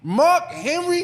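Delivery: high, loud, slow disbelief; really, him?; shocked. Real, not acted. Shocked at choice.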